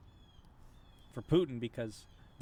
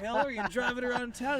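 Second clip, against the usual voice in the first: gloating troll; 12 semitones higher, 8 dB louder than usual. Pretend. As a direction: ham up a smug troll boasting over champagne. Imagining gloating Russian trolls.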